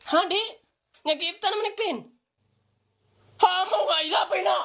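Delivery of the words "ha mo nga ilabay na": shouted command, higher; urgent game shout. Real, not acted. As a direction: yell to throw it now. Shouting to throw grenade.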